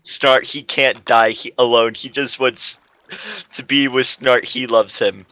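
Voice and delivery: ordinary delivery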